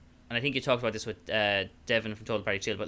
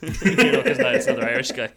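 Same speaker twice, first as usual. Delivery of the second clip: laughing; 10 dB louder than usual. Real, not acted. Laughing at own story.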